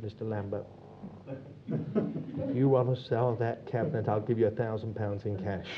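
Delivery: low, hushed aside; confidential offer. Retold or imitated. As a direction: make a discreet cash offer, low and soft. Confidential offer from the antique dealer.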